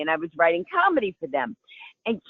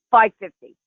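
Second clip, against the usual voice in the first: confident reply; 7 dB louder than usual. Retold or imitated. Answering reporter on phone.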